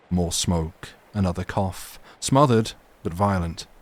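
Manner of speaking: usual speaking voice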